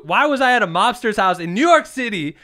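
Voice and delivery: loud, high, incredulous; excited. Real, not acted. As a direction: exclaim it in disbelief. Incredulous outburst about mobster house.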